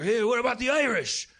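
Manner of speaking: imitating a belligerent Irish guy; Colin Quinn impression. The Irish vet butting in, mock Colin Quinn voice. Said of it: "he was like Colin Quinn Irish".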